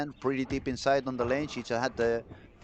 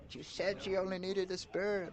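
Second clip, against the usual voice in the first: small, high, soft mock voice; high, quiet put-on audience complaint; deflated audience; 7 semitones higher, 8 dB quieter than usual. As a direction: imitate a let-down crowd, thin and hushed. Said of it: "all the audience drops down like".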